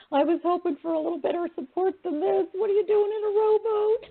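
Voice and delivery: high, quick, laughing mock protest; laughing complaint. Retelling her complaint to Jesus about the rowboat, high and laughing. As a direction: complain through rising laughter.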